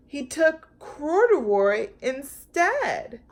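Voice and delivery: usual speaking voice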